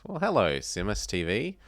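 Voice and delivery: put-on playful American accent greeting a viewer from Maryland. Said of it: "say it in a very American access".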